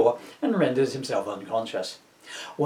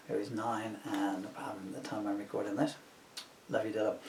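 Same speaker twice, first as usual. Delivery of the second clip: whispering; 4 semitones lower, 12 dB quieter than usual. Real, not acted. Aside whisper about son.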